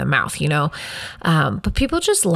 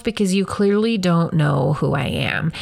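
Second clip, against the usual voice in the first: normal talking voice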